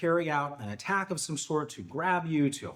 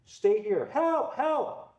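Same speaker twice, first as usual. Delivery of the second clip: high, urgent calls with a firm order first; calling for help; 10 semitones higher, much slower than usual. Shown as a demonstration. Trainer demos holding patient, shouting high for help. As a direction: hold him there and call out for help. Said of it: "while I'm yelling for help".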